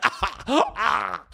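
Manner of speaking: high, hurt wailing; imitated pained cry. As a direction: act out crying with a busted mouth. Acts out bleeding from the mouth.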